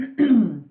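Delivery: a loud put-on throat clearing; hammed-up cough, loud and short; mock throat clearing. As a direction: clear the throat like a faking pupil. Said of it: "he coughed for two".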